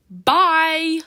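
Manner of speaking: high, loud parting shout; yelled goodbye. A narrator's reading. Drill shouting goodbye as he runs off, loud. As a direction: holler it while running off. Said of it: "Drill yells as he runs".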